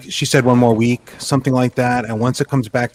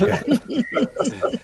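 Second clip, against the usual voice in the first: amused okay; 12 semitones higher, 6 dB quieter than usual. Retold or imitated. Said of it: "And he's like".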